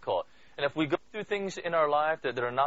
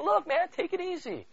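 easygoing reassurance. Retold or imitated. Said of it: "He's saying".

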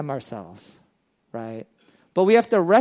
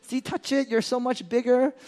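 reassuring parent; 6 semitones higher, 4 dB quieter than usual. Retold or imitated. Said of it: "I'm like".

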